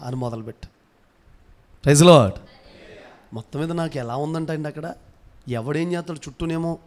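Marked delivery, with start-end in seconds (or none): 1.8-2.4 short, loud praise shout; congregation call. Real, not acted. Calling congregation to respond. (గట్టిగా స్తుతి నినాదం)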